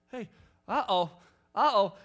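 uh-oh as the bugs find you; rising alarm. Retold or imitated. Chatting outside in Florida, noticing the bugs, alarmed. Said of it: "You're like".

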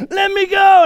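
high, loud re-enacted child protest; struggling daughter's yell. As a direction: yell to be let loose. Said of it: "she's like".

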